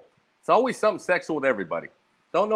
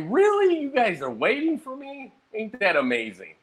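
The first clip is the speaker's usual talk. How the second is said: mock surprise; 7 semitones higher than usual. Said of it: "And I'm like".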